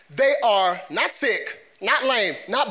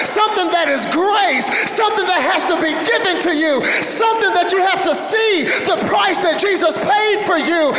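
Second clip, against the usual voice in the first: loud, driving repetition building higher; full-force preaching; 5 semitones higher, 6 dB louder than usual. Real, not acted. Preacher urging church toward true transformation, full-force preaching. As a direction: build relentlessly to a shouted peak.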